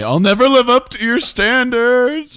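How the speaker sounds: high, loud, slow hammed-up wail; mock despair. Teasing his friend, hammed-up high wail of defeat. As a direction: ham up a crushed rival's lament. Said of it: "then he imploded internally".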